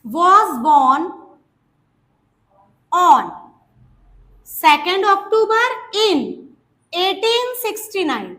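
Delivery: slow teacher dictation. Shown as a demonstration. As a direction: draw each word out for the class. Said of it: "ऐसे अगर हम कहें".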